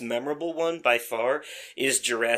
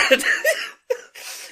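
a high, airless laugh; breathy high laugh; 3 dB louder than usual. Real, not acted. Friend laughing at his own teary confession, a breathy high laugh. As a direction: laugh it off, half embarrassed.

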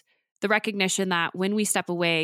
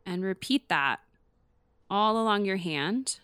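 guided meditation; 3 dB quieter than usual. Acted. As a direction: gently talk them through each breath. Leads a palm-tracing breathing exercise.

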